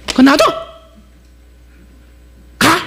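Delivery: mock fishmonger barking high; angry shout. Preacher mimicking the tough fish seller, mock angry. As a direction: snap like a stingy seller. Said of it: "이런 사람입니다".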